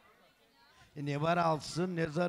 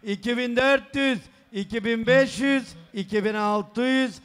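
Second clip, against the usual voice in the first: booming wedding announcer; 4 semitones higher, 5 dB louder than usual. Acted. Counting up gift money aloud.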